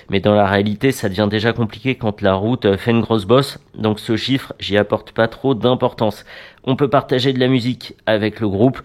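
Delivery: ordinary delivery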